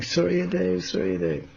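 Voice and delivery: lightly acted high, soft call; voiced distant invocation. Someone heard calling to the sun god, high soft chant-like call.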